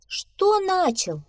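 high, slow astonished question; baffled disbelief; surprised. A narrator's reading. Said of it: "удивилась белка". (удивлённо)

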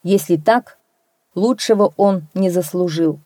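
usual speaking voice

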